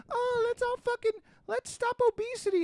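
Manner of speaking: very high-pitched mock voice; mocking naive do-gooder. Mimicking people who only talk about obesity, mocking. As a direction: squeak it out as a clueless idealist.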